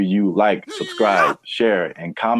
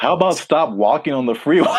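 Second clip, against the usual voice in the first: laughing retort; 7 semitones higher, 4 dB louder than usual. Real, not acted.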